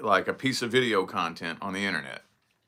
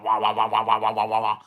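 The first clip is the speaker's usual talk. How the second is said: out-of-tune guitar wah. Shown as a demonstration. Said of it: "that's the sound it makes".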